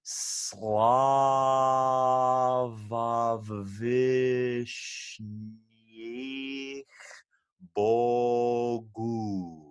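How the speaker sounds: "Slavavishnyich bogu" drawn out, very slow and sustained; slow motion. Shown as a demonstration. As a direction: stretch each syllable like a chant. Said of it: "this whole phrase in slow motion".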